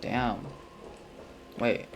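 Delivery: ordinary talk, nothing marked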